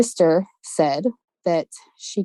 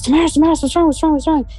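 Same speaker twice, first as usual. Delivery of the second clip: high, urgent worried calls; a sister shaken awake, calling out in alarm; 10 semitones higher, 4 dB louder, much faster than usual. Retold or imitated. Sister woken by screaming friend, calls out in high alarm.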